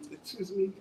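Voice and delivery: ordinary delivery, nothing marked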